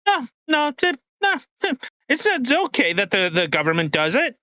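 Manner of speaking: high, squeaky dismissive mockery; mock a smug government voice; mocking dismissive official. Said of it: "And they just".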